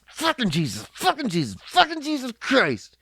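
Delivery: mock angry curse, rising to a shout; hammed-up angry swearing; in anger. Shown as a demonstration. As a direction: spit it out like a furious dad. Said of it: "You can say that in anger".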